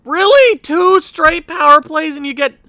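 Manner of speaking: can't believe it; exasperated disbelief. Real, not acted. Second power play fails.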